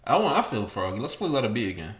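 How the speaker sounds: ordinary talk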